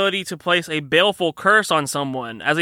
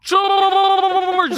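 underwater shout; 5 dB louder than usual. Acted. Calling out underwater.

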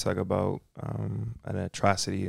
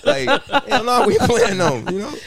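laughing; 13 semitones higher, 8 dB louder than usual. Real, not acted. Laughing while joking about opponents.

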